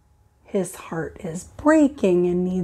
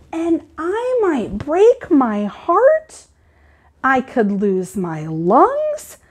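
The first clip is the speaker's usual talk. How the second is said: lively, higher storybook reading voice; reading to children; 7 semitones higher than usual. Acted. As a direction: read it like story time for the class. Teacher reads picture book aloud.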